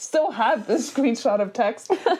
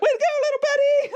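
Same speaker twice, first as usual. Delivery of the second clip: much higher, fast silly praise; proud goofy husband; much faster than usual. Retold or imitated. Husband praising himself over the pregnancy news, high and fast. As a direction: chirp it high and quick like a proud dad.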